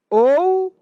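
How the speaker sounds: ou punched high and loud; stress on ou. Shown as a demonstration. Teacher stressing ou so the class sees it means adding. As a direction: hit the word ou hard and high. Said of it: "Toda vez que usa o ou, é o quê? Soma".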